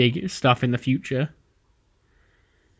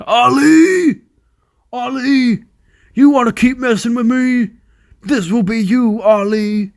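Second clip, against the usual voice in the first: raised, high-pitched imitation of an angry wrestler; irate shouting; 10 semitones higher, 5 dB louder than usual. Wrestler threatening Ali down the camera, mock irate shout. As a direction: act out Samoa Joe screaming into the camera. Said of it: "he shouts. ... He's, like, screaming this into the camera".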